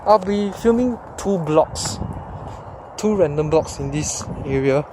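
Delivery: usual speaking voice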